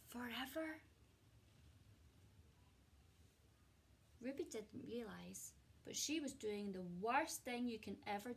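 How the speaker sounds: expressive storybook reading with hushed moments; gentle picture-book narration; reading to children. A narrator's reading. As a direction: read it like a cosy bedtime story to a class. Teacher reads picture book aloud.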